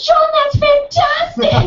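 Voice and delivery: overjoyed high praise; ecstatic cheer. Retold or imitated. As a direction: burst out with joy. Bursting with joy at his answer.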